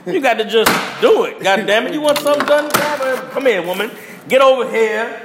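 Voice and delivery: hammed-up macho command. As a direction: boom it out like you're taking charge. Acting out a commanding man.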